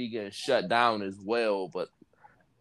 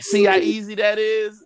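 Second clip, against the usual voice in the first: higher, louder, smug boast; smug friend; 10 semitones higher, 6 dB louder than usual. Retold or imitated. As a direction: sell it like it was nothing.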